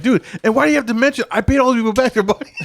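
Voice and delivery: high-pitched aggrieved whine, half laughing; mock protest. Quotes aggrieved protest while laughing.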